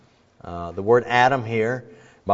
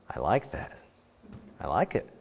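low, very soft acted admiration; hushed approval; 6 semitones lower, 12 dB quieter than usual. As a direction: murmur it as if quietly smitten. Adam admires the woman.

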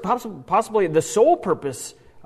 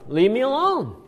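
higher, half-hearted mock shout; demon shouting; 8 semitones higher than usual. Preacher voices the demon interrupting Jesus, mock shout. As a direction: raise the pitch like a demon yelling out. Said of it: "starts shouting".

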